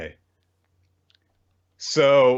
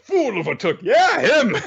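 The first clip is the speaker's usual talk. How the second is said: squeaky; 14 semitones higher, 6 dB louder than usual. Retold or imitated. [name] mimicking Gandalf's line.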